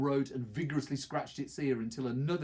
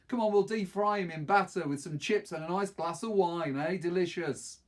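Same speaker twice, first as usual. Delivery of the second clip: high, loud, hammed-up foodie voice; mock-posh joke; 6 semitones higher, 5 dB louder than usual. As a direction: sell the dog dish like a chef. Deke joking about eating dog.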